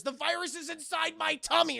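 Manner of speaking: high, loud melodramatic blurt; hammed-up revelation. Woman confesses to agent, high loud exaggerated blurt. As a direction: blurt it out in mock panic.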